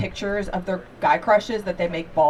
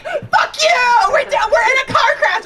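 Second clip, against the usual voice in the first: much higher, loud screaming hysteria; fake panic scream; 13 semitones higher, 6 dB louder than usual. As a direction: scream it like a staged crash victim. Cousin screams into the phone.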